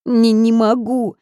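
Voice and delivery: wailing. A narrator's reading. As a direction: break into sobs. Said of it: "заревела снова". (медленно, с рёвом и слезами)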